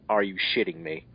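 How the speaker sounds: ordinary delivery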